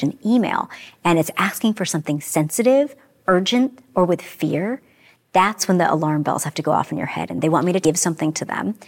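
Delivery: usual speaking voice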